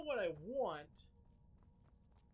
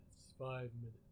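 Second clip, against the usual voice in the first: ordinary delivery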